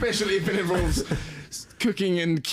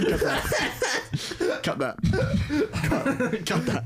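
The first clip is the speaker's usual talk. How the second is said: laughing; 8 semitones higher, much slower than usual. Real, not acted. Laughing apologies to editor.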